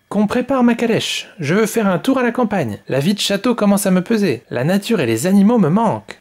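high, imperious little queen's order. Acted. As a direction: command your servants like a spoiled young queen. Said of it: "ordonna un beau jour la petite reine".

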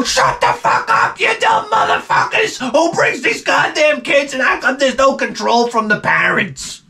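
screaming furious teacher, very high; exploding teacher. Retold or imitated. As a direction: let the teacher finally explode at the class. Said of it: "she just wanted to explode".

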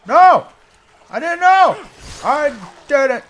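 high, loud pleading yell; frantic pleading. Pretend. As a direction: plead loudly for mercy, hammed-up. Pleads with attacking enemy.

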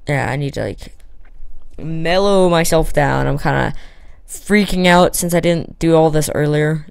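ordinary delivery

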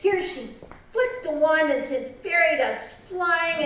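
ordinary delivery

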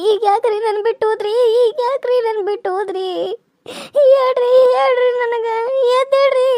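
tearful wailing plea. Acted. Widow begging her dead husband to wake, wailing through tears.

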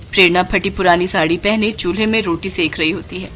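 plain reading voice

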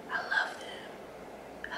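slow, very soft whisper; breathy whisper. Real, not acted. Swooning over sunglasses to her viewers, whispered. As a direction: sigh it out, lovestruck.

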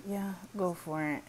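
normal talking voice

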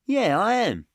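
put-on high, loud boastful boy, ending slow; little tough kid. Josh boasting to the group, high and loud. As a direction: brag like a scrappy kid. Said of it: "said Josh".

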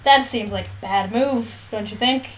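ordinary talk, nothing marked

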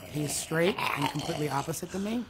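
ordinary talk

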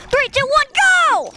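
high, loud starting call; countdown shout. Real, not acted. Starting the contest.

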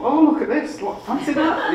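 high, loud mock-boastful squeal; proud showing off. Young designer showing colleagues the first book, proudly boasting.

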